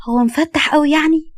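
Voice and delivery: a touch of sheepish worry; nervous. A narrator's reading. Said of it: "تحرك في عيونها بتوتر".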